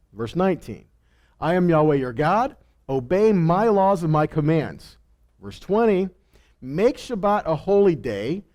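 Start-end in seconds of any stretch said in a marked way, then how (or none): none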